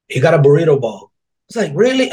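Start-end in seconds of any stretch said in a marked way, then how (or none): 1.8-2.1 high, loud startled "Really?"; a sharp jump of mock surprise; surprised disbelief. Waiter realizing the order is wrong, startled.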